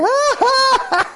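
high, smug fake laugh at the line; mock gloating laugh. Gloating at the crowd in the queue, high mock laugh. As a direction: cackle at the people stuck in line. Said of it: "the people I was laughing at".

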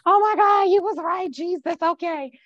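high-pitched mock crying prayer; wailing imitation, starting loud and easing off; tearful surrender. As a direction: act out crying out to God. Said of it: "I'm crying".

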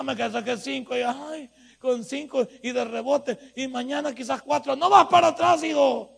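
loud, fervent preacher building to a shout; preaching at full force. Acted. Preacher voicing a struggling listener.

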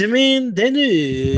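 over-the-top fake Italian name call; worse Italian accent. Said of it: "now you're at a F minus minus".

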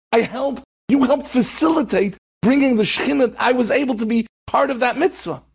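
pleading with his grieving brother to understand; consoling brother. Retold or imitated. Dead brother consoles in a dream.

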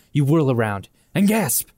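ordinary talk

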